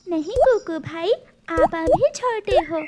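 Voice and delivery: big sister taking charge; caring big sister. Acted. Sister answers brother.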